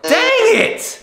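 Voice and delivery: high loud exasperated cry; frustrated shout. Real, not acted. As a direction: yell it out, fed up. Last failure before surgery.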